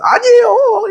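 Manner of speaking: very high mock wail; protesting farmer. Farmer disputing the official's land grade, high mock wail. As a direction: cry out in exaggerated, high-pitched protest.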